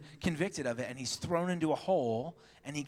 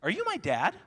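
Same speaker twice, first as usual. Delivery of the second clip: put-on higher little-girl question; put-on kid voice asking dad; imitated little daughter; 4 semitones higher than usual. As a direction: innocently pipe up like a small daughter. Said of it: "she started asking silly questions".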